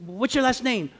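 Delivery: mock curt question, high; snotty clerk. Pharmacy girl asking the old man his name, snotty.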